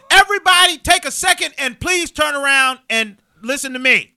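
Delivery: loud, high call for attention; principal addressing crowd. Retold or imitated. Principal calls the freshman line to attention, loud and high. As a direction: boom it out across the whole line. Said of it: "walks over and goes".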